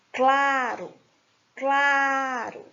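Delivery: "Claro" with CLA held long and strong; drawn-out CLA, light falling "ro" in "claro"; stress on CLA. Shown as a demonstration. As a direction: stretch the first syllable of "claro". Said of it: "Veja que a sílaba tônica".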